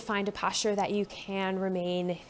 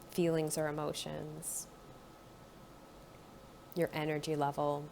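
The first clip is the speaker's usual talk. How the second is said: calm guided body-scan voice; guided meditation; 2 semitones lower, 6 dB quieter than usual. Acted. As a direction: ease the listener inward, gently.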